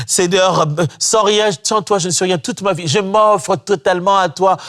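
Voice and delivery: high, lifted worshipful voice, half sung; mock fervent prayer. Mocking the churchgoer who prays with devotion, swooning.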